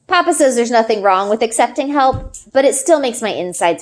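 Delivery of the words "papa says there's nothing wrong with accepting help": usual speaking voice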